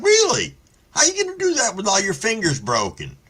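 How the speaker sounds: high, quick mocking "Really?"; mocking father. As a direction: sneer it back like a taunting dad. Father mocking threat quoted.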